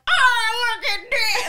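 hammed-up, high-pitched weird voice; weird voice imitation. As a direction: mimic giddy friends in a weird squeal. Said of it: "start doing this weird voice".

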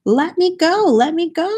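high, pleading leprechaun cry; a panicky shout to be let go. Acted. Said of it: "the leprechaun shouted".

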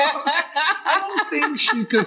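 high, slow, laughing through the words. Real, not acted. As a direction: giggle it out, drawn and high. Laughs at her own doubt.

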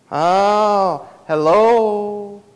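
normal talking voice

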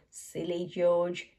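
plain reading voice

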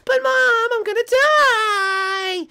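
high, loud, drawn-out mock wail; panicked wail. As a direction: wail to mom in hammed-up terror. Child panics to mom on ride.

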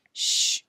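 loud breathy "shh"; shushing. A narrator's reading. Alan hushing his father as Billy sleeps, whispered shush. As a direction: hush someone for a sleeper's sake. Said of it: "whispered Alan to his father".

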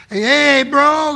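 loud, high street greeting; loud mock street greeting; loud hey bro. Stranger greets loudly on escalator.

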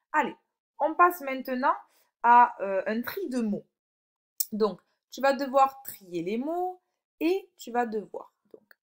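ordinary talk

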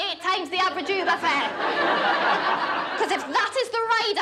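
high-pitched, performed outrage; indignant tirade. Acted. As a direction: build a righteous rant without shouting. Acted indignant tirade at the man.

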